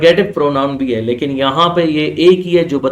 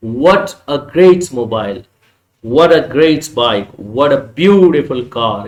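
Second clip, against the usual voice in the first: what a great teacher, slow and louder with a falling tune; exclamatory intonation; 4 dB louder, much slower than usual. Shown as a demonstration. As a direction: sound amazed on what and great. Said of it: "आपने intonation express करना है". (हैरानी भरे लहजे में ज़ोर देकर)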